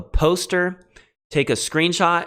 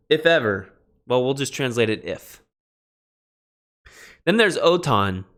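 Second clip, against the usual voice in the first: ordinary talk, nothing marked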